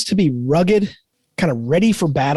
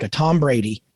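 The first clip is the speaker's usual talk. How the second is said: normal talking voice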